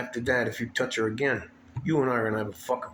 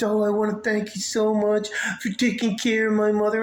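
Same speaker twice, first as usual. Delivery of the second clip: mock sweet thank-you; 8 semitones higher than usual. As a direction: parody her fake thanks, pitched up.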